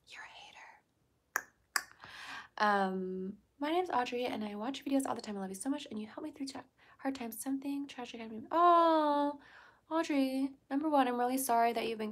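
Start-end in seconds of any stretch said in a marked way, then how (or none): 0.1-1.5 whisper. Pretend. Whispered jab at a commenter. 3.6-8.5 fast reading. Retold or imitated. Reading a fan's message aloud.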